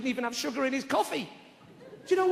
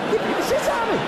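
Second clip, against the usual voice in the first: loud outburst; 13 semitones higher, 8 dB louder than usual. Acted. Punchline outburst.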